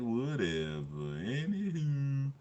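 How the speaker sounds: slow drawn-out Anywho; slow mock brush-off; mock dismissive. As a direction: brush it off lazily, stretching the words. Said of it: "And I said".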